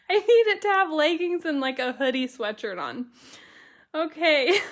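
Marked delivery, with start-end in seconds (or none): none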